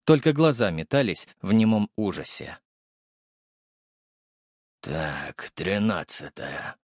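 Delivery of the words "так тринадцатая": low, slow mutter to himself. A narrator's reading. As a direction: mumble the number under your breath. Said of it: "бормотал он".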